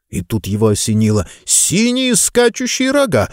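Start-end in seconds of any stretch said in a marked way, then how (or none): 1.4-3.3 high, slow eureka cry; sudden realization. Acted. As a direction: it dawns on you, say it big.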